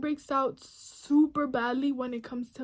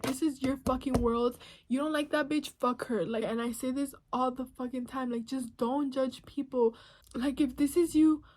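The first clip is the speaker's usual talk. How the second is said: normal talking voice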